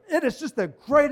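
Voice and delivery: loud, high, hammed-up preacher gushing; showy preacher pretense. Pastor showing his church what pretense is, hammed-up preacher. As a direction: put on a big pulpit show. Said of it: "put on a show and a pretense".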